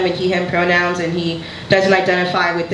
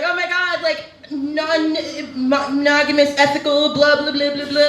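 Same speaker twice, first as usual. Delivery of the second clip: higher-pitched prattle trailing into blah blah; mock agonising; 7 semitones higher than usual. Comedian mimicking people going on about ethical non-monogamy, mocking prattle.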